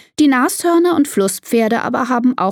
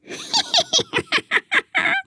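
very high, rapid snickering; monkey giggle; 4 dB quieter than usual. Acted. Monkey awaiting the elephants' act, high giggling. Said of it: "kichert der Affe".

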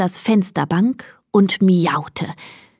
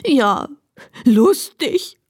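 tearful, higher sniffle; sniffling; 4 semitones higher, slower than usual. A narrator's reading. Donkey Emil answers his comforting friend, sniffling. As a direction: sniffle through a doubtful reply. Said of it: "schniefte Emil".

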